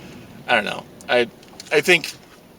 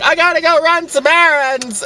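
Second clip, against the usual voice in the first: imitating friend; 11 semitones higher, 4 dB louder than usual. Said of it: "was just like".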